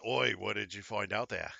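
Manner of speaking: game master's rough British voice; butchered British accent. Acted. Game master as Jack asking what the player found, butchered British accent. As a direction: put on a clumsy British accent. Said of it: "I butchered a British accent".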